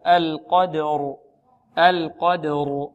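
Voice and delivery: القدر drawn out, ra full and heavy; tajweed recitation. Shown as a demonstration. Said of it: "والراء مفخمة". (تفخيم الراء في القدر)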